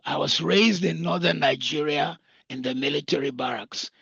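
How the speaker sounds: ordinary talk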